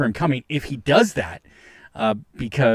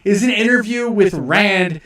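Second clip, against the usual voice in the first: higher, louder incredulous rant; exasperated rant; 6 semitones higher, 6 dB louder than usual. Real, not acted. Streamer ranting at Ralph's claim, higher, louder exasperated disbelief. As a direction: disbelief boiling over at the argument.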